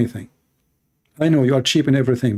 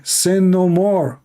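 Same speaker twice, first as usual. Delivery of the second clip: higher, slow commanding quote; quoting the Lord; 5 semitones higher, slower than usual. Retold or imitated. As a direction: proclaim it slowly from above. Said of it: "He said".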